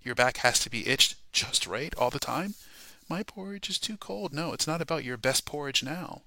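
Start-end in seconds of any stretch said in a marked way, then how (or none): 3.1-4.3 put-on higher, softer petulant child voice; mock picky child; mock whiny complaint. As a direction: whine like Goldilocks. Mocking a picky believer.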